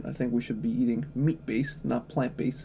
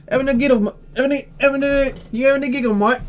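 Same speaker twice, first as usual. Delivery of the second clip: shouted, high-pitched mock British accent; stuttering, squawky British-Australian hybrid accent; put-on British-Australian accent; 11 semitones higher, 7 dB louder, faster than usual. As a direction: badger them in a hammed-up Brit-Aussie accent. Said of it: "I don't know which accent I did".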